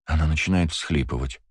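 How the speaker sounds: usual speaking voice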